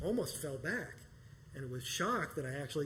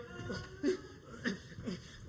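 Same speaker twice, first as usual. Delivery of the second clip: high, silly kung-fu squeals through laughter; mocking the fake karate moves; mock karate noises; 14 semitones higher than usual.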